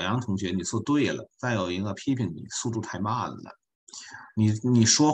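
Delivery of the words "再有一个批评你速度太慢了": ordinary talk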